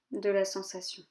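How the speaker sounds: measured yoga nidra guidance with long pauses; calm body-scan voice, leaving space after each instruction; guided meditation. Real, not acted. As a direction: guide them gently inward, phrase by phrase.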